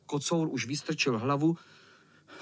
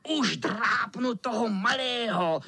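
high, menacing cat voice; hammed-up wicked cat; threatening tomcat; 6 semitones higher than usual. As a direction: squeal the threat at the smallest. Cat threatens the small boy.

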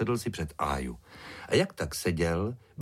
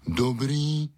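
raised-pitch dog greeting; saying it loudly; 6 semitones higher, 4 dB louder than usual. A narrator's reading. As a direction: call out the greeting. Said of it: "řekl hodně nahlas".

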